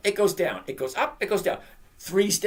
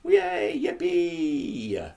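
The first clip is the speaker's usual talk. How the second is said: sarcastic yay, pitched way up; mock cheer; much slower than usual. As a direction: fake delight at a bad number. Mock cheer at the stock price.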